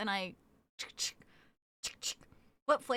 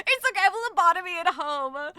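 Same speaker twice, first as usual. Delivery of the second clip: laughing reply; 4 semitones higher, 7 dB louder, faster than usual. Real, not acted. Laughing at chat's mistake.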